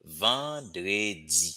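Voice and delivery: Vendredi drawn out slowly; teacher repeats Vendredi slowly; slow model word. Shown as a demonstration. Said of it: "So, samedi is Saturday".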